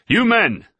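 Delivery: loud, high rebuke; shouted. A narrator's reading. Doctor stopping the sailors' brawl, shouting. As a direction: bark it down the stair. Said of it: "Griffiths shouted down".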